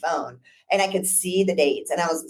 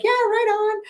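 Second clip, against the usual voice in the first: high mock cool-client voice; laid-back client. Lawyer mimicking relaxed clients, high and breezy. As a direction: toss it off like a chill older client. Clients imitated saying right on.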